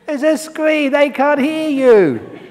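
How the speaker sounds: loud high scoffing shout; mock retort. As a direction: jeer at the fool shouting at the screen. Mocking a moviegoer who shouts at screen.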